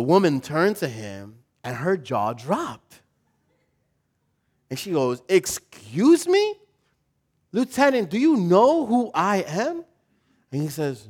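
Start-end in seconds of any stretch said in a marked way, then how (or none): 5.3-9.8 high, slow, offended "Excuse me?"; outraged officer's wife, pitched high and slow; indignant wife. Retold or imitated. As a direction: rise up, scandalized, and drag it out. General's wife indignant at lieutenant.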